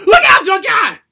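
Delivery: high, loud panicked yell at the TV; warning shout. Retold or imitated. A boy screams a warning at the TV wrestler, frantic. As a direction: scream the warning to your hero.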